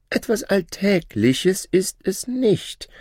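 high, proud voice of a boastful shadow; shadow's voice. Acted. Shadow bragging to his old master, high voice. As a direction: lift the voice high and show off new wealth.

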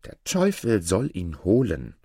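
usual speaking voice

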